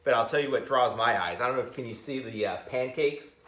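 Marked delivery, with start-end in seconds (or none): none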